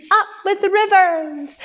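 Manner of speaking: high, delighted hooted chant; high-pitched cheering hoot. A narrator's reading. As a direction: hoot it like a happy duckbill. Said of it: "And they hooted".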